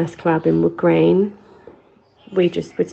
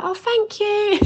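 much higher sweet little thank-you; polite child. Retold or imitated. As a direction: chirp it up like a small kid being polite. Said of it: "You'd be like".

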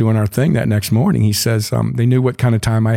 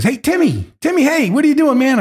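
high, eager shout of a name; excited call. Retold or imitated. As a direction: call out to an old friend, pitched way up. Jimmy calling out excitedly.

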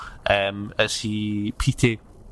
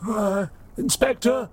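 put-on much higher, fretful old robot voice; old broken robot; 12 semitones higher than usual. Broken robot priest calling to the inspector, high-pitched hopeful voice. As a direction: quaver up high like a failing robot. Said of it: "He goes".

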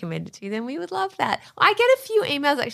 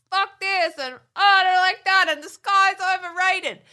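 lightly mocking a grumpy man; a hint of a sour grump; mock grumpy cynic; 8 semitones higher than usual. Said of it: "he's all cynical".

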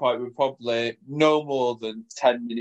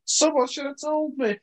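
high, fretful trainer voice, imitated; mock dismay, pitched well up; flustered trainer; 14 semitones higher than usual. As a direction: trainer learning it was his first class, mock fretful. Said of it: "And she goes".

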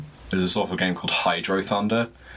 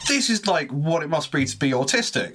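high, overwhelmed and baffled; bewildered; 9 semitones higher than usual. Retold or imitated. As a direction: sound lost in all the colours. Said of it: "was like".

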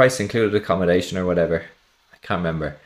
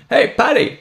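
put-on high, loud call with a German accent; German soldier calling out; 5 dB louder than usual. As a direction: holler it as a comic German. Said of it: "German accent in English very well".

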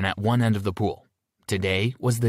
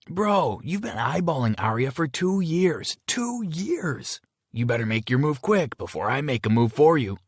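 teasing, higher friend voice; jokingly; 6 semitones higher than usual. A narrator's reading. Jerry teasing his friend about his crush, joking. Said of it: "Jerry said jokingly".

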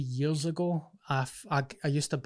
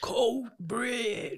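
very high, stretched-out announcer cry; Bruce Buffer announcing; 9 semitones higher, 3 dB quieter than usual. Retold or imitated. Podcaster butting in with the fight announcer impression, high drawn-out call. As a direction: imitate a ring announcer, voice pitched right up. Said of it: "Bruce Buffer, announce that he's for Coatbridge".

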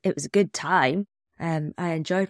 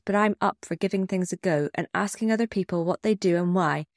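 ordinary delivery

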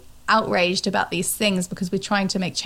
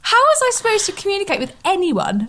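much higher, mock-indignant complaint; mock outrage; 11 semitones higher than usual. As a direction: whine it in hammed-up indignation. Mock outrage continuing Oprah bit.

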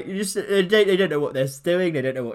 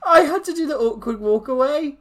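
high, tearful laughing voice; real laugh-cry at her own shame; 9 semitones higher, 4 dB louder than usual. Real, not acted. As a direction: squeal it out through laughter. Repeats the line, laughing-crying.

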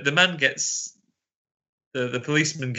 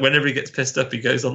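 ordinary talk, nothing marked

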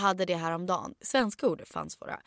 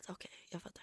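whisper; 2 semitones lower, 22 dB quieter, faster than usual. Real, not acted. Sudden aside while friend tells story.

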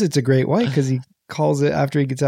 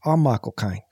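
Michael Caine impression; 6 semitones lower than usual. Shown as a demonstration. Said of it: "do it like this".

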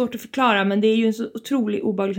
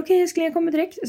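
retold husband reply; 8 semitones higher than usual. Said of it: "då säger han".

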